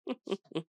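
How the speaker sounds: brief, soft gloating chuckle; villainous chuckle. Acted. Vampire amused by her captive's struggle, soft chuckle. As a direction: chuckle at your helpless prey.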